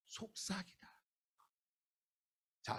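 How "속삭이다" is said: barely audible hush; whispering. Shown as a demonstration. Preacher repeats the meaning for the congregation, whispering. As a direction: say 속삭이다 very softly, as if whispering it.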